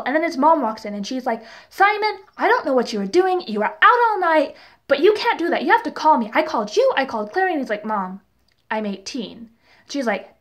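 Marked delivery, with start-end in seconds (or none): 1.7-7.5 higher, worried nagging; imitated scolding mom. Imitating a mom telling off her son, mildly nagging. 7.8-9.5 mock sullen teen. Son answers scolding mom, retold.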